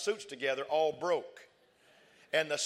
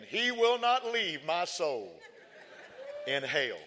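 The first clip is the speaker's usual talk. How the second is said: slow scripture quote; 2 semitones higher, much slower than usual. Acted. Quoting scripture, slowed.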